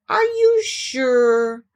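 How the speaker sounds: very slow, drawn-out and high. Acted. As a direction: doubt it with wide eyes. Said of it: "still more surprised".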